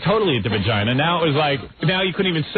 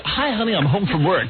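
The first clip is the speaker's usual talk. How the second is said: hammed-up radio DJ voice; a mock DJ voice, higher, saying hi to his wife; DJ voice at home; 6 semitones higher than usual. DJ husband greeting his wife at home, hammed-up radio voice. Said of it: "DJs who talk like that".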